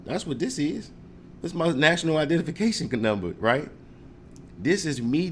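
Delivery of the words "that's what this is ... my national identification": normal talking voice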